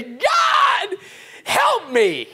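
high, strained cry for help; voice of need. Acted. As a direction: cry out to God as someone in need. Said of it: "That's not the voice of triumph. That's the voice of need".

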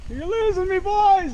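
high call to riders ahead. Real, not acted. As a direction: shout after friends pulling away.

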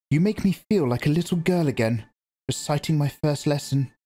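a hint of a proud young mother; lightly voiced Jessica. A narrator's reading. Jessica retorts bitterly.

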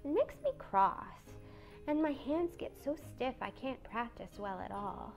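soft, gentle little voice; small, hushed, mild fretting; timid Beth. A narrator's reading. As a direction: complain meekly and quietly.

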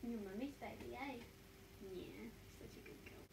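soft cooing, trailing to a whisper. Real, not acted. Owner praising her guide dog in soft baby talk. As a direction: coo softly to the dog.

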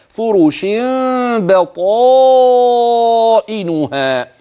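فرش بطائنها with a held meem ghunna into the baa; iqlab demo. Shown as a demonstration. Teacher reciting the phrase to show the hidden meem. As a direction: hum the meem gently, then بطائنها. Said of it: "هكذا،".

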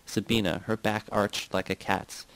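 usual speaking voice

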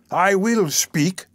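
high, slow, drawn-out protest; insistent cry. A narrator's reading. Bookstall keeper demanding to speak, crying out. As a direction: insist on every word, pitched high. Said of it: "cried the man".

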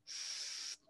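a long, voiceless "sss" for the letter s; isolated S sound. Shown as a demonstration. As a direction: hold s as a pure hiss with no vowel. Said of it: "El sonido s".

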